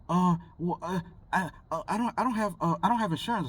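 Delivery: high, halting imitation of a nervous driver; flustered stammer.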